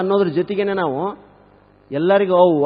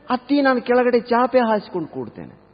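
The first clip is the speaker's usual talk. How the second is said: lightly voiced, higher-pitched daughter-in-law; reply obediently with voice lifted; dutiful new bride; 6 semitones higher than usual. Bride answers her mother-in-law, lightly acted dutiful voice.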